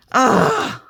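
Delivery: loud, high exasperated ugh; frustrated groan. Real, not acted. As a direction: let out a sharp groan of frustration. Frustrated after slipping with the stencil.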